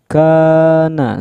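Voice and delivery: chanted long "kaa" in "kana"; two-count long vowel. Shown as a demonstration. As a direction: lengthen the first a of "kana", then drop. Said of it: "sama panjangnya".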